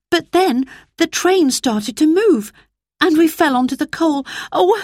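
put-on high, anxious girl's voice; Roberta explaining. A narrator's reading. Girl apologising to the driver, anxious and sorry. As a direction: pour out an apology as a young girl.

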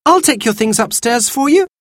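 put-on high, over-eager child; high-pitched, urgent helpfulness; anxious offer. A narrator's reading. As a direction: offer quickly to cover panic. Girl anxiously tries to stop Mother.

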